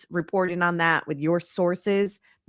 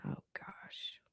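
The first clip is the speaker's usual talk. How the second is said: breathy under-the-breath gasp; whispering; 23 dB quieter than usual. Real, not acted. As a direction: whisper it to yourself. Aside while finding screenshot.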